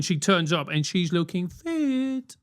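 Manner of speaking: usual speaking voice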